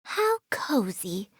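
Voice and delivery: slow, soft half-whisper; cozy contentment. Acted. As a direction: savour it softly, drawing it out. Savours a pleasant breeze.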